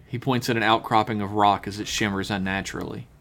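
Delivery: normal talking voice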